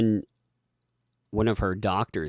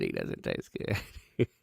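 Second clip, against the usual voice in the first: whispered aside; 14 semitones lower, 8 dB quieter, much slower than usual. Acted. Jokey repeat of the line.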